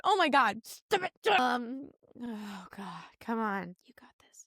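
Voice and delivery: choked up, sinking to a near-whisper; really fighting tears, talking yourself through it; getting emotional. Real, not acted. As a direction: emotion takes over, voice dropping away. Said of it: "Why I'm getting emotional".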